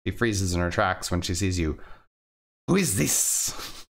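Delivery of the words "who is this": suspicious woman voice. Acted.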